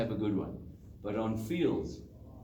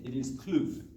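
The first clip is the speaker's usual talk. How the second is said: Kloof in a KZN accent, said "Kluf". Shown as a demonstration. Coach demos the local accent: Kloof as "Kluf". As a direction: say "Kloof" the Durban way, a short "Kluf". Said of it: "get the accent right".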